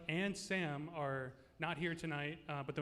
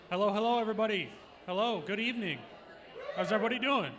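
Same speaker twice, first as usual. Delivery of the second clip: in front of an audience; 3 semitones higher, 6 dB louder than usual. Real, not acted. Host greeting crowd on mic.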